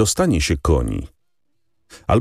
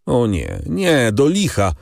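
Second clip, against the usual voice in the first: a much higher, slow outcry of refusal; exclaiming; 7 semitones higher, slower than usual. A narrator's reading. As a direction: refuse it outright, dragging the words. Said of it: "zawołał Albert". (wysoki, powolny okrzyk sprzeciwu)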